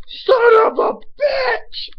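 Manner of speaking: drawn-out, high, full-force scream; retold yell. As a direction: re-enact the outburst at the top of your voice. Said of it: "I jumped up and I yelled".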